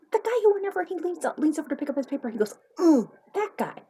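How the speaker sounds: high, loud, exasperated wife re-explaining; worked-up nagging, pitched right up; exasperated insistence. Acted. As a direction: spell it out to a husband who won't get it. Exasperated wife repeating her description.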